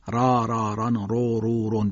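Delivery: "را، را، ران، رو، رو، رون" with thick, dark r and back vowels; heavy ra. Shown as a demonstration. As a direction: chant the syllables full-mouthed, one by one.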